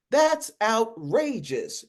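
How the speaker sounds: high and slow, drawn out in disbelief; outraged protest. Acted. Lawyer protests the witness program plan, slow and outraged. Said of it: "Razor protested".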